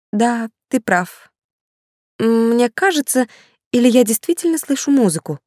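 lightly voiced doubtful young woman. A narrator's reading. Girl on phone, suspicious.

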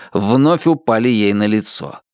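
ordinary talk

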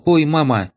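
very high loud pained cry; painful yelp. Acted. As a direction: shriek from a sudden smack. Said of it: "очередному".

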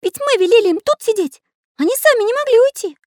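very high, loud piping voice; worried little artist. A narrator's reading. Little artist puzzles over the missing children, high and fretful. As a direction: fret in a high character voice.

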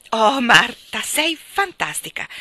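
loud, joyful "Oh" then a high, slow "fantastica"; delighted cry. A narrator's reading. Mary thrilled by Marta's plan, crying out. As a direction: call it out with pure delight. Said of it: "gridò Mary".

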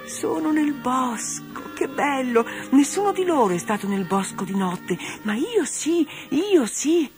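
high, slow, enchanted repeating; blissful delight. Acted. Doll Dora telling herself she is in the woods at night, high and rapt. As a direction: hug the words with wonder. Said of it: "continuava a ripetersi".

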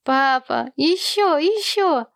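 put-on very high, eager child voice; begging little boy. A narrator's reading. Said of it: "попросил малыш Сэм".

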